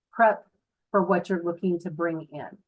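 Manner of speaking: ordinary delivery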